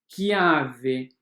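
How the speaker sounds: chiave said as "kiave", hard k. Shown as a demonstration. Teacher to students showing chiave with a k. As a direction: model chiave as "kiave", no sh sound. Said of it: "Escrevemos C H e pronunciamos K".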